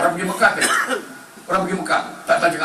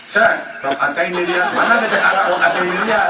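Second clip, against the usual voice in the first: louder, higher, laughing through it; 5 semitones higher, 5 dB louder than usual. Acted. Preacher laughing at pilgrim's bad Arabic, loud.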